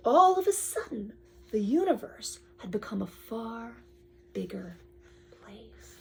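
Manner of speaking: read-aloud fading into a whisper; storybook reveal. Acted. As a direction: let the wonder hush down to nothing. Reading, fading to hush.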